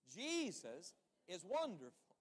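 slow, full-voiced stress on "Jesus". Shown as a demonstration. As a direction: draw out "Jesus" and "wonderful" with heart. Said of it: "with a little more life".

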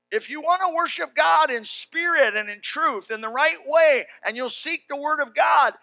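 loud, high shouted preaching; preaching at full force. Acted. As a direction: thunder it from the pulpit.

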